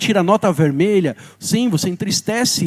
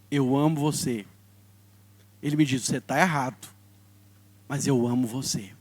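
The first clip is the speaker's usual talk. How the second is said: lower, soft and tender; gentle divine voice; 4 semitones lower, 5 dB quieter than usual. Acted. The Holy Spirit comforting the angry seminarian, lower and tender. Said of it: "o Espírito Santo veio falar comigo".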